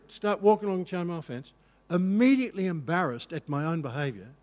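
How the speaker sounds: normal talking voice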